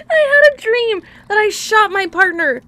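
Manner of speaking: loud, high-pitched and tearful from laughing; real tearful laughter. Real, not acted. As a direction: struggle to get the story out through laughter. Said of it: "I'm gonna cry".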